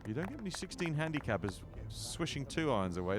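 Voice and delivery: ordinary talk, nothing marked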